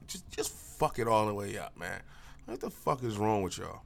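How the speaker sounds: normal talking voice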